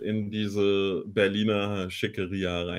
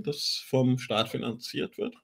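normal talking voice

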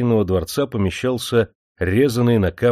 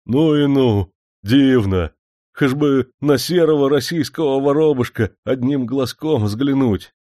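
higher, louder, marvelling cries; delighted exclaiming; 6 semitones higher, 5 dB louder than usual. A narrator's reading. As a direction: gasp with wonder and longing. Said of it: "ахал и всё повторял".

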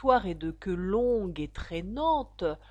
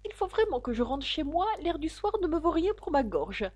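put-on high-pitched fussy old bird; murmuring old magpie; 9 semitones higher than usual. A narrator's reading. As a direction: fuss in a high voice, excusing yourself. (voix aiguë de vieille pie)

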